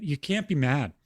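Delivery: ordinary talk, nothing marked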